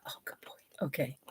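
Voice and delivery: breathy hushed praise for the dog; whispered pet praise. Real, not acted.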